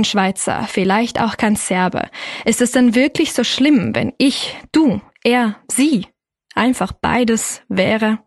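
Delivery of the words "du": usual speaking voice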